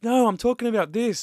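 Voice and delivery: high-pitched, impatient correction; exasperated, insistent retelling; retold exasperation. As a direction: push the pitch up, frustrated with mum. Retells snapping at his mum.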